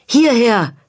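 high, loud call for help. A narrator's reading. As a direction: shout to someone far off. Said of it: "rief er".

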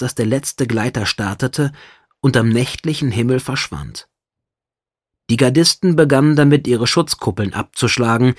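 ordinary delivery

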